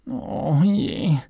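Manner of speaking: hohes, enttäuschtes Stöhnen; gequält aufseufzen; stöhnend. A narrator's reading. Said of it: "stöhnte der Maulwurf". (jammernder Maulwurf)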